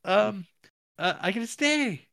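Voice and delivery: hammed-up overjoyed good news; squeaky mock delight; mock cheerful announcer. Mocking character's happy news.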